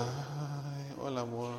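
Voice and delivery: soft, drawn-out weary wife; esposa desanimada. Acted. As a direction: imitate an unenthused wife, soft and low. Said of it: "Y la esposa".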